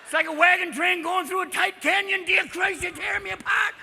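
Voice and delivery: put-on high, loud, squawking old-timer yelp; cackling sidekick in pain; hammed-up old cowboy wail; Walter Brennan. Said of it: "Walter Brennan".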